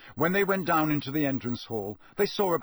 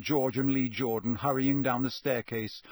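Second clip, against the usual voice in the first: ordinary delivery, nothing marked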